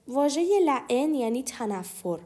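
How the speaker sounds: usual speaking voice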